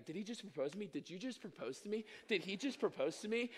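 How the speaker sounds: flabbergasted. Retold or imitated. Embarrassed woman turns to her friend after a surprise proposal, flabbergasted. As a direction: retell her flustered disbelief under the breath. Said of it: "she was flabbergasted. She was embarrassed".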